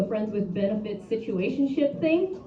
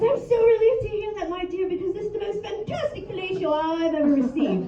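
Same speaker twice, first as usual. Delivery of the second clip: high, prim, proper gentleman voice; posh gentleman; 9 semitones higher than usual. Retold or imitated. She imitates her polite lover in a prim, high gentleman voice. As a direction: play him as a genteel old-fashioned gent. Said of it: "He says".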